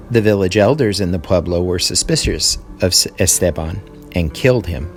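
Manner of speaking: ordinary talk, nothing marked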